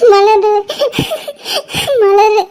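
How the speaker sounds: sobbing out a friend's name; crying. Acted. Crying to her friend who asks what happened, sobbing. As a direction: gasp the name between sobs. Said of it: "என்னடி அழுதுட்டு இருக்க".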